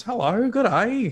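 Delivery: high, drawn-out mock Australian hello; Australian greeting. Streamer greeting a viewer's friend, hammed-up Australian accent. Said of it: "true Australian fashion".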